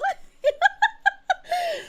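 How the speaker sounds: a high, falling burst of laughter. Real, not acted. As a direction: laugh it off high after the bit. Laugh after mock line.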